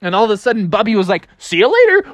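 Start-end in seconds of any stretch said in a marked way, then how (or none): 1.4-2.1 cheerful farewell. Acted. Bear shoots away cheerfully.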